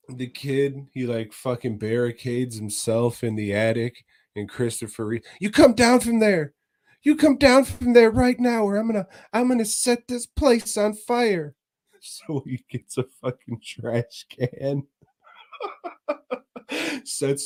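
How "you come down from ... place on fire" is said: high, loud threatening yell; mock yell. Reeve yelling at the kid in the attic, high mock threats. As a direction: bellow up at the attic.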